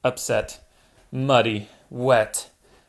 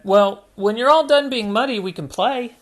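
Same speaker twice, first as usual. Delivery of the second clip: put-on higher, brighter girl voice; Hannah's voice; 9 semitones higher, 5 dB louder than usual. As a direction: offer to play later, lifted up. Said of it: "Hannah said".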